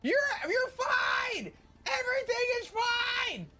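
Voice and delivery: high, slow, strained shouting; frantic scream. Retold or imitated. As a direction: yell it like everything is falling apart. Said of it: "hear me going".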